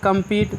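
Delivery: "कम्पीट" with a long ee, final e silent. Shown as a demonstration. Teacher applies the same rule for learners, long ee in "कम्पीट". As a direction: say "कम्पीट" ending in a long eet. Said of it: "इसी नियम से पढ़ते हैं".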